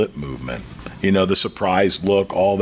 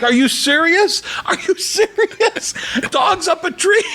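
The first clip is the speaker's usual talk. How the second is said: high, drawn-out disbelief breaking into laughter; incredulous laughing; slower than usual. Retold or imitated. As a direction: laugh through a retold stunned question. Retold disbelief at a joke.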